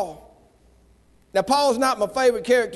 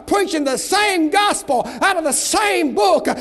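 preaching at full force; 5 semitones higher than usual. Acted. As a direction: shout it to the crowd, building higher.

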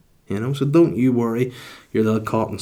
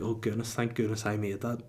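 much softer, breathy-free murmur of relief; hushed relief; 11 dB quieter than usual. Retold or imitated. Someone who nearly missed the toilet, hushed relief. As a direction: sigh it out as if you just made it.

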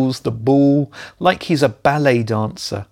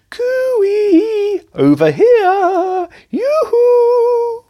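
very high, drawn-out sing-song calling; camp flirty call; 5 dB louder, much slower than usual. Retold or imitated. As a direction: trill it high like flirting across a pitch. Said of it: "my pal said".

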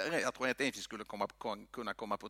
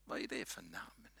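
ordinary delivery

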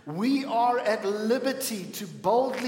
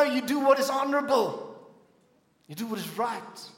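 usual speaking voice

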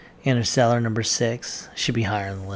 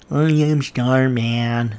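slow, louder, stiff alien monotone; Starman voice; 6 dB louder, much slower than usual. Retold or imitated. Vlogger imitates the film alien Starman to viewers, slow stiff mock voice. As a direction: imitate the alien learning to speak. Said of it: "That's how he talks".

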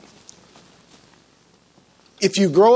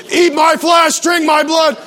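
loud, high mock preacher shout; booming proclamation; 6 semitones higher, 5 dB louder than usual. Guest speaker shocking the church, booming proclamation. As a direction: hammer it out at full voice.

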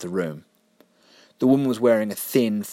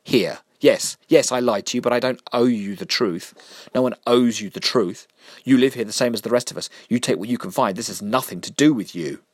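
loud, raised angry rant; angry tirade; 4 semitones higher, 4 dB louder than usual. Acted. Mister Vinegar ranting angrily at Jessica. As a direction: let the anger pour out at her.

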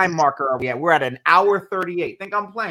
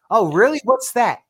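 higher, louder intrigued questions; acted curiosity; 5 semitones higher, 6 dB louder than usual. Someone asking about your joy, acted curiosity. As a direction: perk up as if newly intrigued.

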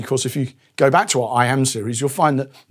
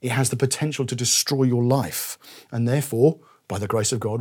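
quiet, earnest doctor's warning; softer, sincere, pleading with the patient; a hint of a caring doctor warning gently; loving doctor; 4 semitones lower, 6 dB quieter than usual. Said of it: "a loving doctor at that point would say".

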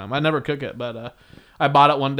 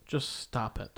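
quiet plea; 3 semitones lower, 13 dB quieter, much slower than usual. Retold or imitated. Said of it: "You're like".

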